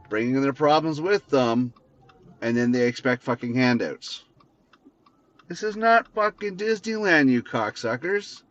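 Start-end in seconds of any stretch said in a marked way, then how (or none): 5.5-8.4 angry outburst. Real, not acted. Angry rant climax.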